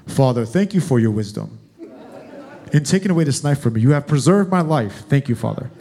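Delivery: low, solemn little son giving thanks; mock deep voice. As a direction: put on a comically deep, formal voice. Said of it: "His voice is almost that deep".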